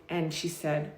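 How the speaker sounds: ordinary talk, nothing marked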